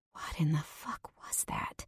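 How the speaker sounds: breathless whispered disbelief, very soft; shocked whisper. Acted. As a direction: hiss it out in a stunned whisper. Panting after fleeing the room.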